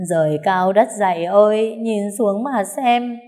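sobbing out the complaint to heaven; a grieving old woman wailing to the sky; crying while speaking. A narrator's reading. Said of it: "vừa khóc vừa nói".

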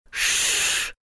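soft, drawn-out hushing hiss; hissed shush. A narrator's reading. Worried rabbit silencing the girl, hissed shush. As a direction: hush her with a long hiss. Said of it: "зашипел кролик".

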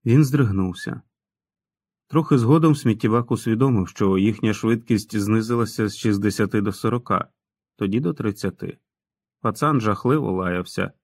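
ordinary delivery, nothing marked

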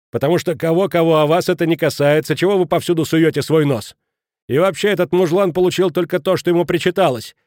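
high, loud hectoring; heated rebuke. A narrator's reading. Kraner confronting the meddling headmaster, heated. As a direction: lay into them forcefully.

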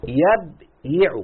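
"يبيع" stretched very slowly, each syllable held; drawn-out demo. Shown as a demonstration. Teacher showing the student the present tense, drawn out slowly. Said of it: "أقول له شوف".

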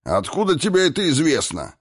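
high, sharp suspicious query. A narrator's reading. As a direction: question him with open distrust. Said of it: "подозрительно осведомился гном". (подозрительно, высоким голосом)

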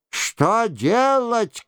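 high, drawn-out frantic question; crying out. A narrator's reading. Breathless monk asks what to do, crying out. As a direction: wail the question high and slow. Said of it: "кричал, запыхавшись".

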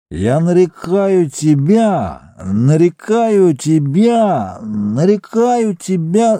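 put-on slow slurred drunken mumble; drunk slurring. A narrator's reading. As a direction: mumble it drunk, tongue tangled. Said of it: "заплетающимся языком бормотал Бикс". (пьяное бормотание)

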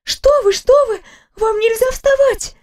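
a startled nurse waving her patient back down; alarmed nurse. Acted.

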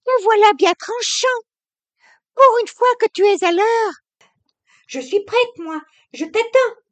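put-on high, loud, brisk young woman teasing her husband; young wife, sharp. A narrator's reading. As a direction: snap back with impatient gaiety. Narrator voices Camille.

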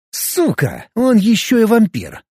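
sharp angry outburst, rising high; angry curse. A narrator's reading. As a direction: swear in pain and rage. Hero curses in mid-fight. (злое ругательство)

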